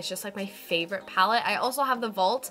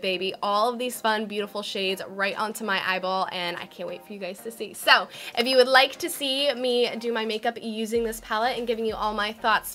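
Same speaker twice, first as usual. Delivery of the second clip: ordinary talk, nothing marked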